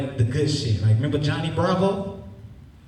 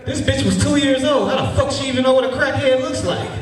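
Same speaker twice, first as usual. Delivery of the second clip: indignant rant; 10 semitones higher than usual. Real, not acted. Ranting about toddler insult.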